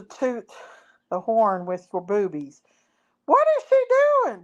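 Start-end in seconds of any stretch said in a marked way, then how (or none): 3.3-4.4 squeaky high voice. Real, not acted.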